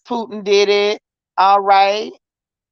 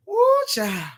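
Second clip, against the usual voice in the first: high, breathless woo of relief; exasperated exclaim; 10 semitones higher than usual. Real, not acted. Host to chat, exclaiming high and breathless. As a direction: let it burst out way up high.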